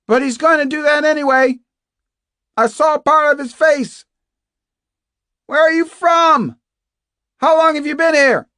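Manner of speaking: high, loud, urgent yell; Kate McTiernan shouting. Acted. Captive questioning an unseen fellow prisoner through walls, shouting. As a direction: keep shouting for answers. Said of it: "shouted Kate".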